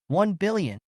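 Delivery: high, stunned disbelief; shocked. Acted. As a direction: gasp the number out in shock. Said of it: "looked at her phone in shock".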